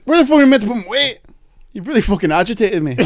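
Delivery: put-on high, fast, laughing Scottish accent rant; mock Scottish accent. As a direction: rattle it off in a hammed-up Scottish accent. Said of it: "That's, like, Scottish".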